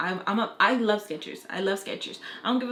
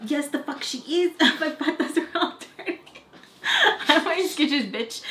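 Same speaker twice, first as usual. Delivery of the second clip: higher, breaking into laughter; laughing; 6 semitones higher than usual. Real, not acted. Repeating own comeback about Skechers, laughing. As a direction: crack up while trying to repeat the line.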